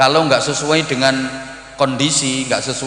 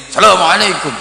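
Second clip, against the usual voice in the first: high, loud greeting; 6 semitones higher, 6 dB louder than usual. Shown as a demonstration. Pupil meeting his teacher in Arabia, high loud greeting. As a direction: call it out high and hard to the teacher. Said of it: "pakai nada tinggi, keras".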